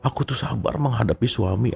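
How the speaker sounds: soft, mild wife's self-talk; lightly voiced patient wife. Wife telling herself she is patient with husband, softly voiced. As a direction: say it softly, as a long-suffering wife.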